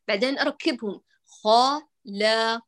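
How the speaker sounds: ordinary talk, nothing marked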